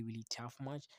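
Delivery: ordinary talk, nothing marked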